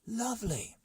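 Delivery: high happy exclamation; delighted cry. Acted. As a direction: cry out with joy. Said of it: "cried Luigi".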